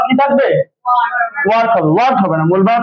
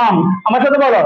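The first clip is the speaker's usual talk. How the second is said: ordinary delivery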